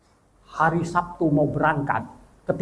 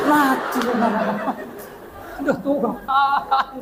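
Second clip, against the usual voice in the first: laughing despair; 10 semitones higher than usual. Retold or imitated. Despair at the lost ticket.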